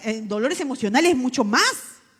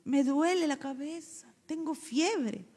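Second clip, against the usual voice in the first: put-on whiny child; 5 semitones higher, slower than usual. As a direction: play a kid who says he is sick. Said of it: "Ahora no". (niño quejándose, lento y suave)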